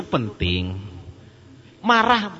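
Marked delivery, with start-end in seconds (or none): 1.8-2.3 high, loud burst on one word; a sudden angry outburst shown to the crowd; mock angry shout. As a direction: bark it out like a flash of anger. Preacher acting out anger.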